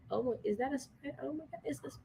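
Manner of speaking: soft, hushed spider panic; hushed alarm. Real, not acted. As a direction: hush it, eyes on the spider.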